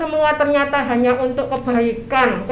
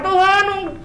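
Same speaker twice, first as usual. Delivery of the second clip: higher, demanding, rude mock prayer; preacher mocking a cheeky prayer, high and pushy; insolent prayer; 6 semitones higher, 4 dB louder than usual. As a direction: scold God as if He owes you. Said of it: "Itu doa kurang ajar".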